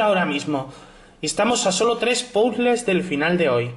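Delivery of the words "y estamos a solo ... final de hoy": ordinary talk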